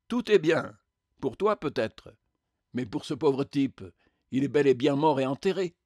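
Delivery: incredulous, bitter retort; indignant spectre. Acted. Spectre answers Jack's killer, incredulous and indignant. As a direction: throw the reassurance back in disbelief.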